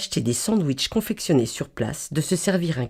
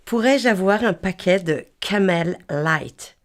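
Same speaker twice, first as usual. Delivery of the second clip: ordinary delivery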